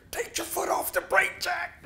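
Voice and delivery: high whispered wife voice; high hushed wife voice; wife's whisper. Retold or imitated.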